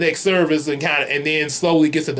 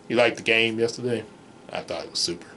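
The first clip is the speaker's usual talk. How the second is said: low, soft laid-back voice; relaxed friend mumbling low; chill friend; 8 semitones lower, 7 dB quieter, faster than usual. Retold or imitated. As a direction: drop low and lazy, totally chilling.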